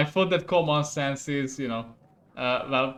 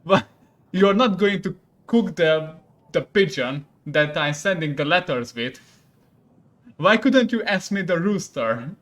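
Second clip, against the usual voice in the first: angry scolding; 3 semitones higher, 7 dB louder than usual. Acted. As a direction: demand an answer from the cook. King scolds the cook.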